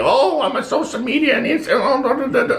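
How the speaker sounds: high, whiny mock voice of a social media complainer; mocking social media whiner. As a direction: sneer at them in a silly put-on voice. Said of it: "who gets caught up on social media".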